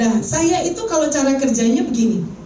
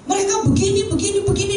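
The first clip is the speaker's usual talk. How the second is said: exasperated rant; 9 semitones higher than usual. Retold or imitated. Teacher ranting about lazy colleagues.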